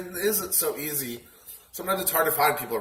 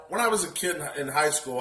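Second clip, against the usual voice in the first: normal talking voice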